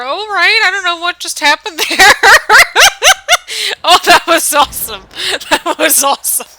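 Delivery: loud, high, laughing with delight; excited laughing. Real, not acted. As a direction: burst out laughing in disbelief. Reacts to wild in-game move.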